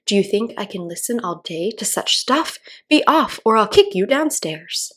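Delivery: sing-song verse recital; poem recitation. A narrator's reading. Alice reciting for the caterpillar, sing-song. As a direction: recite it like a schoolgirl's rhyme.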